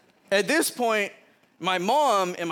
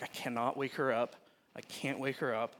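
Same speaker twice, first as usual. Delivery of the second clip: softer, hushed worried mutter to self; hushed inner panic; 5 semitones lower, 9 dB quieter than usual. Retold or imitated. Sneaking past his sleepwalking sister, hushed and tense. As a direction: keep it under your breath so she won't wake.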